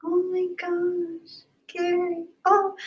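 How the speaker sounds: high, soft, slow and breathy with emotion; choked-up awe. Real, not acted. Streamer moved by a friend's kind gift, soft choked-up awe. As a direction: let it catch in your throat.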